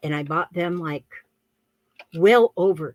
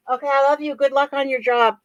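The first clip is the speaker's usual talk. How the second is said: higher, raised-voice send-off; calling goodbye; 5 semitones higher, 5 dB louder, faster than usual. Real, not acted. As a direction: call it after someone leaving for work. Calls goodbye to departing daughter.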